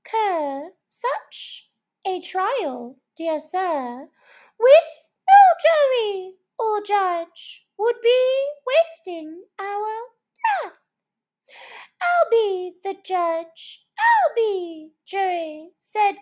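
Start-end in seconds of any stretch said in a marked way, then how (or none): 0.9-10.8 high, slow mouse protesting; pleading mouse. Acted. Mouse pleading with the cur, high and slow. 12.0-15.7 high, slow sly boasting; cunning Fury. Acted. Cunning dog gloating over the mouse, high and sly.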